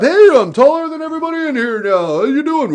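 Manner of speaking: playful high greeting from up tall; silly tall-guy greeting. Shown as a demonstration. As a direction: show off being tall, playfully. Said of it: "stand up".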